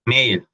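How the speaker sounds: "mail" said as "meil"; English AI sound. Shown as a demonstration. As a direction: glide the vowel of "mail" from e to i. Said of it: "se convierte en el sonido ale".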